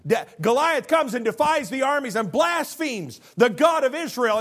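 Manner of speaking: loud, high preaching build. Acted. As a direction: raise the voice like a revival preacher. Preaching at full force.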